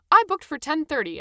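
offering it up helpfully. A narrator's reading. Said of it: "I said helpfully".

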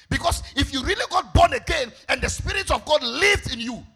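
full-voiced pulpit exhortation; forceful preaching. Real, not acted. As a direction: preach it out, hammering each phrase.

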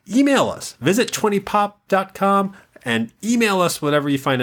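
high, eager call to action; excited plug. Acted. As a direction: hype up the listeners to email. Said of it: "hyping the audience".

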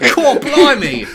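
loud, high-pitched caricature Brit; squawked British exclamation; hammed-up British accent at full volume; mock British accent. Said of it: "put on a British accent".

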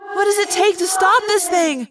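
high-pitched desperate cry for help. A narrator's reading. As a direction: ask it desperately, voice pushed up high. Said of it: "Cassie asked me desperately".

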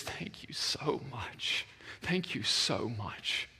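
slow, hushed, whispery thanks; soft, drawn-out gratitude; tearfully grateful. Retold or imitated. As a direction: act out a man moved to tears. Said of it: "He comes up with tears in his eyes".

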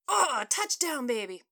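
put-on slow, high, loud whoop on "Oh" that settles into "baby"; celebrating fan. Fan cheers his team's score in the dining room, loud whoop. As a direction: cheer the touchdown like you won.

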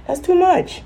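mock squeal of exasperation; squeaky exasperation. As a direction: jump up high, fed up. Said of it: "And I'm like".

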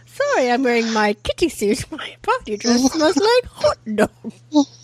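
hammed-up, botched Australian accent; really stupid Australian accent. Fan repeating Patches' favourite line, horrible Australian accent. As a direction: mangle it in a janky fake Australian twang. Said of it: "she has this really stupid accent".

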